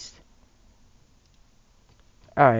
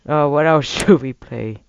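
ordinary talk

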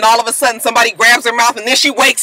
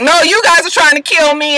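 high, louder protest; accusing; 10 semitones higher, 5 dB louder than usual. Retold or imitated. Andrew accusing Olivia, high and loud. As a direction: throw it back at her. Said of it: "And he was just like".

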